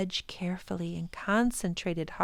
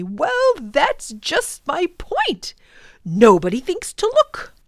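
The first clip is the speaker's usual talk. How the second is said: much louder, higher burst of triumph; emphatic professor; 8 semitones higher, 12 dB louder than usual. Acted. Professor proving his point to Ben, loud and triumphant. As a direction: slap the knee and drive the point home.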